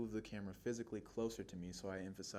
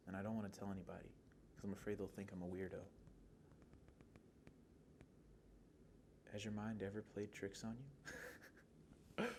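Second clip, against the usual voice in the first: soft, hesitant confession with long pauses; subdued monologue performance; 8 dB quieter than usual. Acted. Teen confiding strange thoughts to someone, soft and hesitant. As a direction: confide it softly, as if embarrassed. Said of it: "we'll get into the performance of it".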